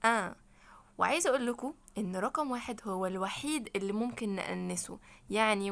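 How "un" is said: "آن" louder, as a nasal vowel; nasalised "un". Shown as a demonstration. Teacher drills French number one, nasal "آن". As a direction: send "آن" through the nose without a final N. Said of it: "نخنف كده شوية".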